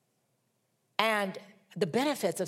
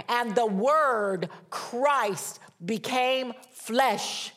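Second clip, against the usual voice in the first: emphatic pulpit reading, words punched out; impassioned scripture preaching; 4 semitones higher, 3 dB louder than usual. Acted. Preacher reading John's gospel to the congregation, emphatic proclamation. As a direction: proclaim the verse with weight on key words.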